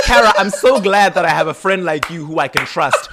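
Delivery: hammed-up pointed gratitude, high and loud; Lena hamming it up. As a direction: lay on the loaded trust far too thick. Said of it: "she's hamming it up so much".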